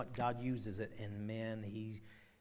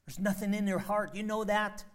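much louder and higher, pressing; forceful Spirit voice; 8 semitones higher, 9 dB louder than usual. Acted. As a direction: drive the conviction home. Voicing Spirit, intense.

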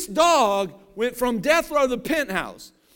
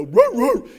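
dog woofing; 8 dB louder than usual. Retold or imitated. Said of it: "that dog would go".

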